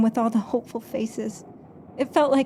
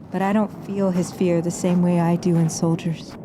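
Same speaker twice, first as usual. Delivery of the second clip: ordinary talk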